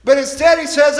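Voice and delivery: ordinary talk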